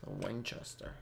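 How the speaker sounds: murmured, soft and low; quiet naming. Pretend. Gamer noting a weapon to himself, quiet naming. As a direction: say it softly to yourself. Says weapon name two ways.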